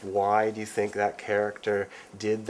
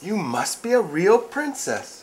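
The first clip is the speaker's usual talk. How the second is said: high, slow, thrilled; delighted prince; 10 semitones higher, 5 dB louder, slower than usual. Acted. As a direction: marvel at her.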